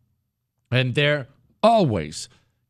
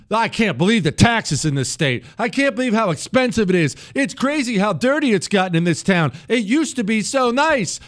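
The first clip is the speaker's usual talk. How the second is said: higher, whining complainer; mock Californian griping about taxes; whiny mock complainer; 7 semitones higher than usual. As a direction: moan about the state like a fed-up voter. Voicing a complaining Democrat.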